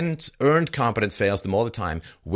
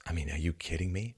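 usual speaking voice

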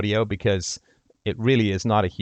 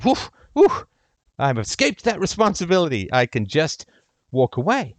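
mocking voice; 7 semitones higher, 4 dB louder than usual. Mocking someone escaping responsibility.

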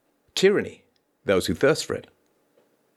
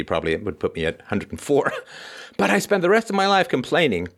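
ordinary talk, nothing marked

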